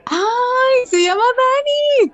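high squeal of delight at the name. Real, not acted. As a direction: gush over the lovely name. Reacting to a viewer's name.

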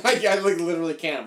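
laughing too hard to talk; breaking up with laughter. Real, not acted. Refuses accent, laughing.

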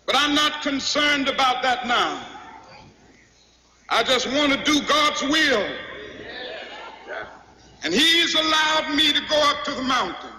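measured sermon building in fervour; fervent preaching. Real, not acted. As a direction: testify to the congregation, stretching key words. Preaches to a responding congregation.

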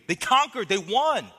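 loud, high cry of victory; triumphant shout. Acted. Preacher echoing the victors' screams, shouting triumphantly. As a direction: proclaim the win at full voice.

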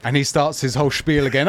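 ordinary talk, nothing marked

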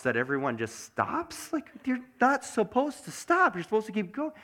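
high-pitched exasperated rant; comic driver's outburst, pitch shooting up; worked up. Real, not acted. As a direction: get agitated, pitch climbing as you protest. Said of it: "I'm getting a little, uh, uneasy".

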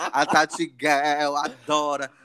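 laughing through the words. Real, not acted. Host laughing about the singer [name], laughing. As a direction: laugh it out, a bit slow.

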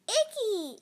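high, loud, gleeful squeal; laughing child's cry. A narrator's reading. Little girl at a slimy monster, gleeful cry. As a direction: squeal it out in delight. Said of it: "she laughed".

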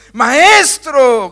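loud desperate cry of Maestro; shouted plea. Acted. Preacher voicing desperate disciples, a loud cry. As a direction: call out Maestro like a panicked sailor.

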